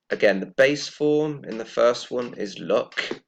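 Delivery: ordinary delivery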